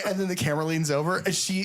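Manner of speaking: ordinary delivery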